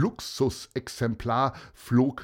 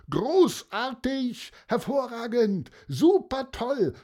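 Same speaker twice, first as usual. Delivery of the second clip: enthusiastic voices; 12 semitones higher than usual. A narrator's reading. Spectators cheering the kite show, high delighted cheers. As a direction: cheer each word up high. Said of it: "begeisterte Stimmen".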